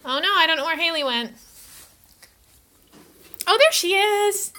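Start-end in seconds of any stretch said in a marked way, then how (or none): 3.5-4.5 high delighted find; bright high discovery; pleased surprise. Real, not acted. Finds the cat.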